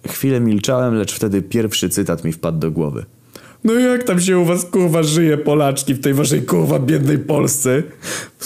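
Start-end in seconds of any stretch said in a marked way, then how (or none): 3.6-7.8 mocking crowd speech.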